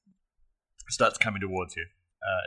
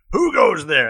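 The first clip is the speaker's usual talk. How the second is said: orc shout; 10 semitones higher, 13 dB louder, slower than usual. Acted. GM voices orc again.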